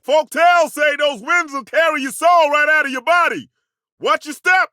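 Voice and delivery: yelling a warning to the party; shouting warning. Acted. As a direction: shout it across a gale. Warning over howling winds.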